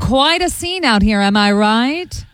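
formal live-on-scene reporter voice; cliche news reporter. Shown as a demonstration. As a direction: play up the local TV field reporter. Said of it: "cliche news report".

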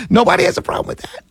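high, fast, breathy mock disbelief; sarcastic disbelief. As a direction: toss it off as if nobody could care. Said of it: "I'm dead serious".

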